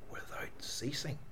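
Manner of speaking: hushed echo of the verse, almost breath; whisper. Shown as a demonstration. Preacher repeating the verse to the congregation in a whisper. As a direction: let the words fall to a whisper. Verse echoed softly after plain reading.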